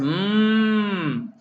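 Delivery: a hummed mock moo; lightly imitated cow lowing. Therapist imitating a toy cow for parents. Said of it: "la vaca hace".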